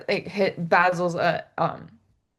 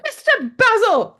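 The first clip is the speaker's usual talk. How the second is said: high, loud reprimand; mock scolding shout; 8 dB louder than usual. Housekeeper scolding Basil over the pillows, loud. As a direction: call him out sharply.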